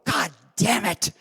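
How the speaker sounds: sharp, high, impatient barking of orders; mock furious command; angry order. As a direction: bark it like a furious old star. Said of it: "and said".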